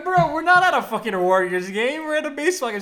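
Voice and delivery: high, loud retold protest; exasperated correction. Correcting a friend at the baseball game, exasperated. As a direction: set a drunk friend straight, exasperated.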